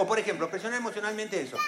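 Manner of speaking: normal talking voice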